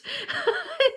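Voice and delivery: short high burst of laughter. Real, not acted. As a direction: laugh at the absurdity. Laughs retelling husband's reaction.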